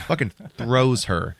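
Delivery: usual speaking voice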